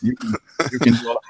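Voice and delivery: imitating an intimidating old coworker, low and hushed; Braun impression. Speaker imitating his coworker Braun's advice on intimidation, low and soft. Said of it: "I'm trying to do a Braun impression".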